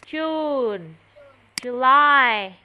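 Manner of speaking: usual speaking voice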